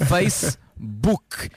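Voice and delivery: Facebook stretched out very slowly; Facebook, very slow, to show the syllables; slow syllable demo. Shown as a demonstration. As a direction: say Facebook as one long drawn-out syllable group. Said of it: "É uma".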